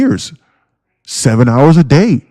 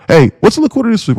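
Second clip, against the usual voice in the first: high clueless newbie voice; mock newbie question; 10 semitones higher, faster than usual. Streamer imitating Discord members, high clueless newbie voice. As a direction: ask it like a lost beginner.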